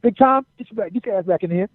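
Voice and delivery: high-pitched imitation of an older colleague calling him back; Arn Anderson. Arn Anderson calls the wrestler back in, high mock summons. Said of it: "Arn Anderson comes out".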